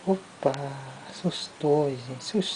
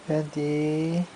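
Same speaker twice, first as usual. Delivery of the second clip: usual speaking voice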